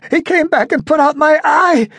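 high, loud near-tearful voice; on the verge of tears; almost crying. A narrator's reading. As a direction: ventriloquist confessing, high and close to tears. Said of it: "almost crying".

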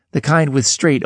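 ordinary delivery

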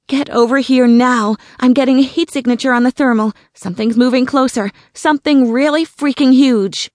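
loud, fast, urgent; urgent radio call. A narrator's reading. Sarah urging her team over the radio to come now. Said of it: "She spoke into the radio".

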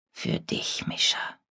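barely audible whisper. Acted. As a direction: breathe it out, almost soundless. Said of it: "flüsterte er kaum hörbar". (kaum hörbar geflüstert)

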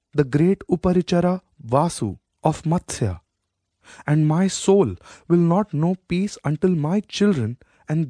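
a queen demanding her birthright; proud queen mother. Acted. Satya, the queen, presses her son to reclaim the throne.

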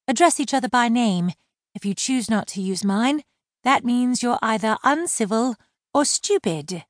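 high-voiced mermaid explaining manners; prim mermaid. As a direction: lecture him politely. Laurel lecturing.

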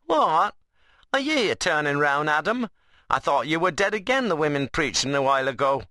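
high, incredulous rustic dialect; Ben, cheeky workman. Acted. Ben taunts Adam about turning round, mock-surprised. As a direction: play a workman goading his mate. Ben's figure voice.

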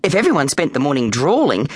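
ordinary delivery

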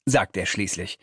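ordinary delivery

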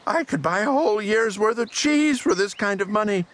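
high, outraged storybook shriek; high-pitched, aghast squawk. A narrator's reading. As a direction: shriek it in shock at the price. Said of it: "I shrieked".

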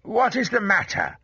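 father's high, insistent demand; crying out. A narrator's reading. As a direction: call out the question, pitched well up. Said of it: "he cried".

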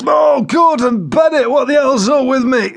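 Joe Windass wailing. A narrator's reading. Joe despairs at another burst, wailing loudly. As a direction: exclaim in despair at your own body.

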